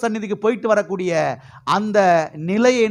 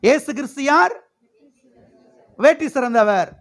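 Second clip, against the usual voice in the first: high rising questions, ringing answers; preaching call-and-response; 5 semitones higher than usual. Real, not acted. Preacher drilling church on who they are, rousing call-and-response. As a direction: quiz the crowd, then proclaim the answer high.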